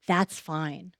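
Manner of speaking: ordinary talk, nothing marked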